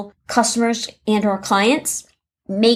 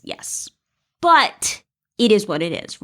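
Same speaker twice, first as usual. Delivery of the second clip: ordinary talk, nothing marked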